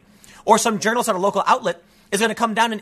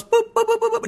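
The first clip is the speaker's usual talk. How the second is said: rapid, high-pitched boops; string of quick high beeps; imitated phone beeping. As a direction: mimic a phone pinging nonstop. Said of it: "if I turn it on at all, it just goes".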